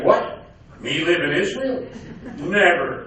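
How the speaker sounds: put-on loud, incredulous Yiddish accent. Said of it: "he talked with a heavy Yiddish accent".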